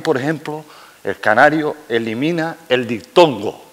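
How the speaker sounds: ordinary talk, nothing marked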